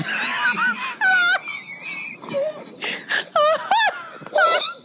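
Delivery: very high helpless laughter; long squealing laugh; laughing fit. Real, not acted. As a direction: crack up until it squeals. Laughing at gremlin insult.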